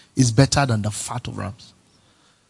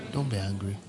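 softer reminder; 4 semitones lower, 10 dB quieter than usual. Shown as a demonstration. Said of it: "tell the person".

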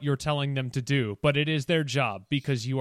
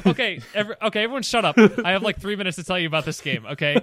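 loud, high, laughing over everyone; laughing shout; 5 semitones higher, 7 dB louder, faster than usual. Real, not acted. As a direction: laugh while shouting them down.

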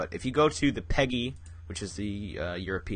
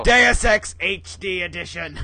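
announcer voice; 8 semitones higher, 8 dB louder, slower than usual. Pretend. Grand mock title.

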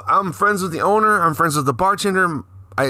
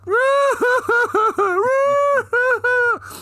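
very high-pitched imitated wailing; hammed-up high bawling; mock louder crying. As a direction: do a drawn-out, over-the-top fake wail. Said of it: "she starts getting louder".

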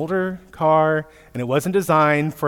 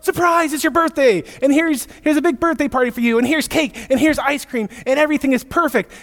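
much higher, gushing party announcement; excited; 8 semitones higher than usual. Retold or imitated. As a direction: pile on the treats with glee. Parent announcing birthday surprise.

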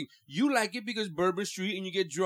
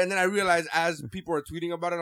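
normal talking voice

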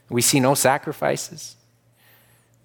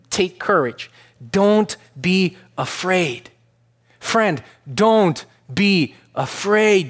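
tender voice of Jesus, drawn out; gentle Jesus voice; 6 semitones higher, slower than usual. Retold or imitated. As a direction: speak as Jesus comforting a frightened man, slowly. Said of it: "he says. Like".

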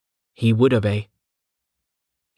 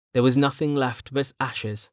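usual speaking voice

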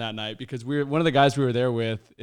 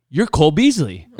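insistent claim; 3 semitones higher, 10 dB louder than usual. Retold or imitated. Stranger insisting loudly in store.

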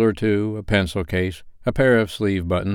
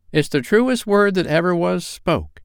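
laundress's voice; 10 semitones higher than usual. A narrator's reading. Dilber voiced.

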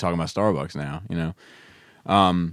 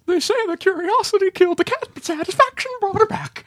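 high falsetto voice. Acted. Parody of the [name] line.

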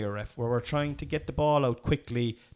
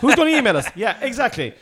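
laughing; 9 semitones higher, 9 dB louder than usual. Real, not acted. Laughing at own joke.

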